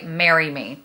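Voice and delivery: ordinary delivery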